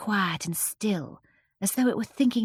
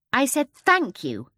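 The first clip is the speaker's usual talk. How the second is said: "thank you" louder and higher, slow and pointed; 7 semitones higher, 4 dB louder, slower than usual. A narrator's reading. Mary Poppins rebuking the greedy starling, louder. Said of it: "a little louder".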